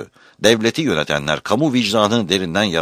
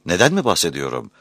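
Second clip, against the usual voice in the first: ordinary delivery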